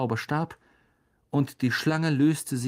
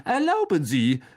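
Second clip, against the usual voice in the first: higher, raised interjection; called out; 4 semitones higher, 4 dB louder than usual. A narrator's reading. Teacher stepping in against the snake, calling out. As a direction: cut in with a showy call. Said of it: "rief Lockhart".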